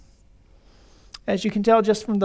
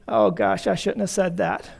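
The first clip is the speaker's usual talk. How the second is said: regretful quiet admission; 3 semitones lower, 5 dB quieter than usual. Retold or imitated. Congregant realizing too late, mildly rueful and hushed. Regret after blurting in anger.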